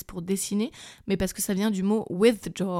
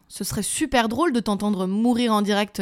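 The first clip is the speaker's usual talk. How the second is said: usual speaking voice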